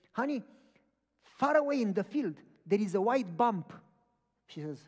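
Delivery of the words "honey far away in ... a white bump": loud, high excited call to his wife. Retold or imitated. Driving husband tells his wife about a bump, excited. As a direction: point it out urgently.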